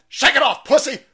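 urging himself past the hangover with a shout; loud taunt. Real, not acted. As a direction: bark it at yourself. Yelling at himself, hungover.

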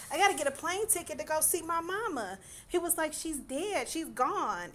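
hammed-up tearful begging for a plane ticket; mock crying plea. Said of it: "And he's crying".

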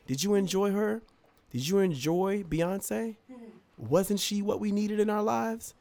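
a lightly mocking gushy host; gushing hostess. Greeting his ex back from the concert, high and gushing.

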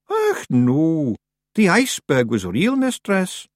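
high Scots Feegle voice; Daft Woolly. A narrator's reading. Feegle correcting the witch about the iceberg, high Scots protest. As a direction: protest earnestly as a little Scottish creature.

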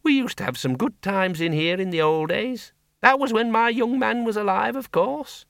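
put-on a blunt old farm woman talking plainly; Miss Flitworth. A narrator's reading.

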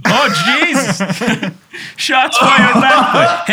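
loud, laughing, slowed exclamation; laughing shout. Real, not acted.

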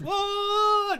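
high, loud hammed-up yelp; mock shout. Retelling the kid being knocked flying, high mock shout. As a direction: yell it up high like flying off a bike. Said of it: "And he just goes".

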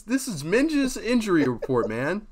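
confident. Shown as a demonstration. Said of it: "Say it with confidence".

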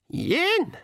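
high-pitched pixie call; old pixie counting. A narrator's reading. Old pixie starts counting down to the shot, high call. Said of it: "said the old pixie".

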